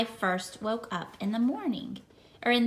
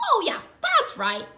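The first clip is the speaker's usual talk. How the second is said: dog voice; 8 semitones higher than usual. Acted. Dog answers cheerfully.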